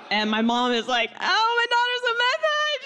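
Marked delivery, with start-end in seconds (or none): 1.2-2.8 very high wailing mom; mock sobbing. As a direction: wail it in a hammed-up, hysterical sob. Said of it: "sobbing, sobbing over her".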